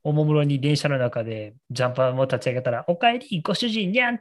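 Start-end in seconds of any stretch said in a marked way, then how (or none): 2.9-4.2 high-pitched imitation of a cute girl character; cat-girl voice. Cat-girl voice blaring through a train car, high imitation. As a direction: mimic a high cat-maid greeting. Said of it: "女の子の声が車両中に響き渡って".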